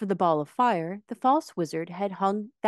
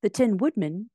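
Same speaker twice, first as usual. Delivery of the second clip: plain reading voice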